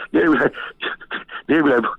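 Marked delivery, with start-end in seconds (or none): none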